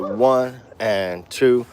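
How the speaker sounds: slow, even count on the ands; half-time count with the ands. Shown as a demonstration. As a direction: spell out each beat slowly. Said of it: "slowed it down a half the time".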